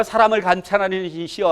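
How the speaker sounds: normal talking voice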